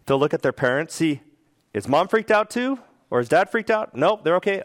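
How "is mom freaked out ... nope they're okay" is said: put-on wary child. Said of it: "if a child sees something potentially scary".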